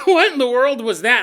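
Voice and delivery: loud, high imitation of a rattled man; amped-up disbelief. Older man after the confrontation, amped-up disbelief. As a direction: burst out incredulous and keyed up. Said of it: "obviously, he's super amped up".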